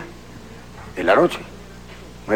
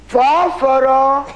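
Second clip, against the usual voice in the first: drawn-out mock plea; 11 semitones higher, much slower than usual. Pasajero repite, muy lento.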